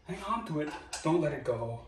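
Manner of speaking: badly faked Arnold Schwarzenegger Austrian accent; terrible Arnold Schwarzenegger accent. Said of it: "don't know what accent that was".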